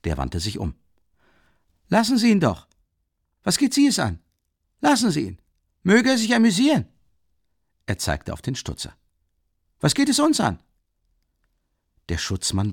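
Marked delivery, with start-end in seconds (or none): 1.9-6.9 high-pitched, urgent shouted appeal; agitated calling out. A narrator's reading. Raskolnikow urges the policeman to let it be, crying out. 9.8-10.6 very high-pitched cry of dismissal; agitated exclamation. A narrator's reading. Raskolnikow tells the policeman to stay out, crying out.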